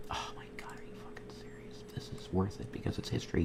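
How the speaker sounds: whispered, awestruck; awed whisper. Real, not acted. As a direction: breathe it out in a hushed whisper. Whispering in awe at logo.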